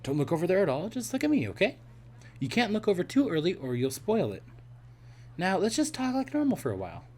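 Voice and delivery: a hint of a playful young woman scheming; Sally's voice.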